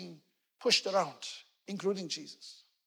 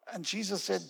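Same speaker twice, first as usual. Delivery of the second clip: ordinary delivery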